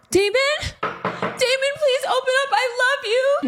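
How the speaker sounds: very high falsetto, loud, begging through a door; pleading ex-girlfriend. Retold or imitated. Ex-girlfriend begging Damon through the door, high and pleading. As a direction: mimic a desperate woman calling through the door. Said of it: "I hear at the other side of the door".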